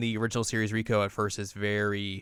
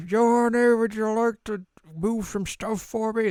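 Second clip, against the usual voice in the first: high, loud put-on old-timer voice; old man; 12 semitones higher, 4 dB louder than usual. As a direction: call out as an old man asking a favor. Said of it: "this old guy's like".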